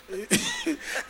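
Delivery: short high-pitched laugh; quiet high laugh; chuckle. Real, not acted. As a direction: let out a high little giggle. Laughs after admitting one curse.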